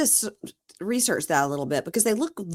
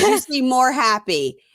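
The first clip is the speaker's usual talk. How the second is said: slow mock explanation; 7 semitones higher, 7 dB louder, slower than usual. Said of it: "He says".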